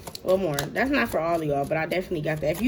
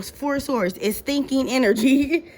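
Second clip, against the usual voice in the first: ordinary talk, nothing marked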